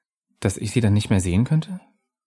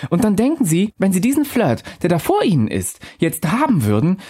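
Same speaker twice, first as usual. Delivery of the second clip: higher, airy spirit voice, calm and knowing; a lightly raised ghost voice musing to the narrator; ghostly Undine; 7 semitones higher than usual. Acted.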